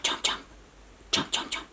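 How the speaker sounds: soft, sneaky crocodile whisper; whispered chomps. Acted. Reading to a child, crocodile chomps whispered. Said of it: "Goes chomp".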